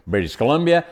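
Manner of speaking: usual speaking voice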